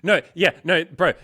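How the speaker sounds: loud, high burst of agreement; eager loud bro; excited. Real, not acted. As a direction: jump in loudly, high.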